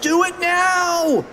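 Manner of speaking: high, drawn-out frantic order; slow high-pitched cartoon shriek; mock scream. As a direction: stretch it into a squealed command. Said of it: "screamed Fluffy".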